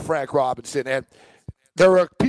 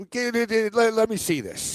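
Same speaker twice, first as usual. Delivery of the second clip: eager demand; 7 semitones higher than usual. Retold or imitated. Manager grabbing for the watch.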